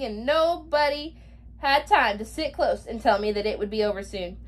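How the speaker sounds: ordinary delivery, nothing marked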